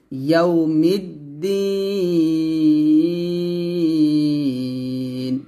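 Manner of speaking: ইয়াউমিদ্দিন chanted very slowly, the ee before দ্দিন held for three beats; drawn-out recitation. Shown as a demonstration. Teacher shows the long madd to students, slow chant. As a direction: stretch ইয়াউমিদ্দিন long in Quranic chant, end on a still নুন. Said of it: "তিন টান দিয়ে নুনকে সাকিন করব".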